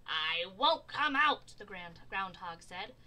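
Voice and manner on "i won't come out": loud, slow refusal; storybook groundhog's firm no; stubborn refusal. A narrator's reading. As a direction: dig in your heels, drawing it out.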